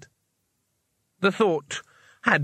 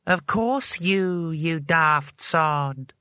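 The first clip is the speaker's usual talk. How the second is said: very slow, drawn-out spell voice; rustling old voice; 4 semitones higher, much slower than usual. A narrator's reading. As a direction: scold slowly, like old pages rustling. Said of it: "a voice like the rustle of old pages".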